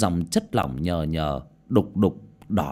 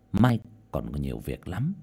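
ordinary delivery, nothing marked

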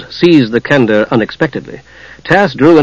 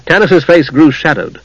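plain reading voice

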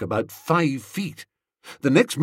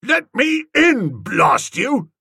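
angry; 12 semitones higher, 4 dB louder, slower than usual. Acted. Tuppy locked out, demanding entry.

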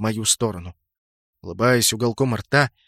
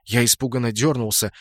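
ordinary delivery

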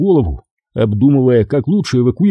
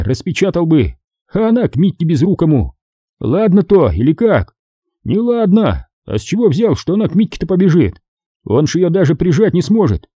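raised, higher, heated soldier's voice; rowdy soldier arguing; 6 semitones higher than usual. Acted. Young soldier heatedly arguing with comrades about marriage. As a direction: argue it out like a young soldier in barracks.

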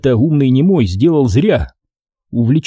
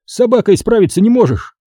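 raised, vexed scolding of a friend; annoyed reproach; 8 semitones higher than usual. A narrator's reading. Max to Ivanych, scolding him, higher and vexed. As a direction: scold him for fumbling the dog. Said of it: "Досадливо укорил он меня".

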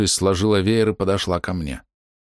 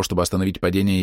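ordinary delivery

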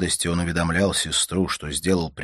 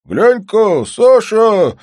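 high, loud holler; drawn-out, high-pitched shout; calling from afar; 12 dB louder, much slower than usual. A narrator's reading. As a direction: call out loudly across the yard. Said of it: "закричал Лежнев издали".

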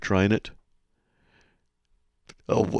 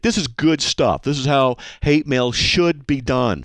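ordinary talk

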